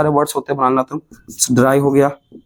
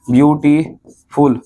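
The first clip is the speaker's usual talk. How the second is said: Beautiful drawn out slowly, each syllable spaced; teacher stretching beautiful syllable by syllable; slow syllable reading; much slower than usual. Shown as a demonstration. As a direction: sound out beautiful slowly for the class. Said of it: "पढ़ो".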